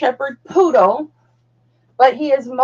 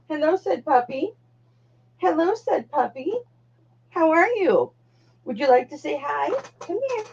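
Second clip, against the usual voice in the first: talking to puppy; 5 semitones higher than usual. Real, not acted. Greeting the puppy.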